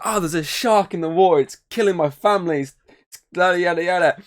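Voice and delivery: high, loud mock panic into the phone; hammed-up frantic mother calling police; panicked mum. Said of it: "So that's like".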